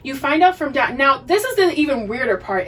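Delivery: ordinary talk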